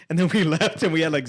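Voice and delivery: ordinary talk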